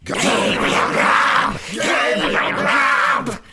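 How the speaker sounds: very high, slow screamed chant; wild chorus strain. A narrator's reading. Invisible chorus replies to the goblin, screaming slowly. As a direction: wail the name out like a chorus on the wind. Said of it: "the invisible chorus replied in a strain".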